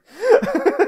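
sudden high bursting laugh; burst of laughter. Real, not acted. Friends recounting a show, sudden high laugh. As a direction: laugh out loud at the story.

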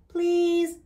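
put-on high, childlike plea; pleading. A narrator's reading. Charlie begs Grumpy to sing.

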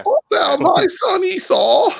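shrieking high, loud mock English accent; over-the-top falsetto English accent; hammed-up English accent. Shown as a demonstration. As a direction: squeal it slowly in an absurd British voice. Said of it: "why does yours always sound like berries and cream".

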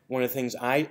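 ordinary talk, nothing marked

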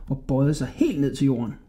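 ordinary delivery

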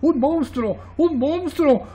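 high-pitched storybook panic; alarmed cries. A narrator's reading. Coloured shapes seeing a monster, panicked cries. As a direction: cry it out high, twice over. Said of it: "gritan las figuras de colores".

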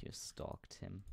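soft slow whispered jab. Real, not acted. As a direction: whisper it, slowly. Whispered accusation.

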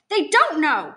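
loud, high anguished outcry; crying out. Acted. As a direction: cry it out in desperate denial. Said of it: "she cries".

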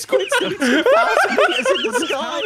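laughing. Real, not acted. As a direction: howl it out laughing. Friend flying high on rocket.

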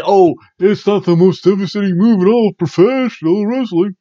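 mock the critics who wave the move off; mock sarcastic quote. Host mocks critics of the falcon arrow, sarcastic. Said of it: "Just say".

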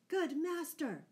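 high-pitched cry to the master; alarmed cry. A narrator's reading. Scarlet finds his master Robin bleeding, high cry. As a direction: cry out on finding your master hurt. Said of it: "cried Scarlet".

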